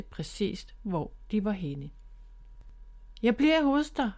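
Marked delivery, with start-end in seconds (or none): 3.2-4.1 higher reassuring call. Acted. Bob calls to his sister that he'll stay, reassuring call. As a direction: shout your promise across the water. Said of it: "råbte Bob til Miffy".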